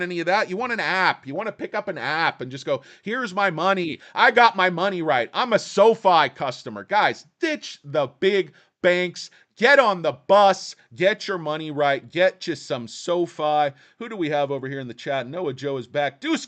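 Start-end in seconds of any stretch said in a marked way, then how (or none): 3.0-6.8 excited. Pretend. Imagined happy app user. 6.8-13.7 rally cry. Real, not acted.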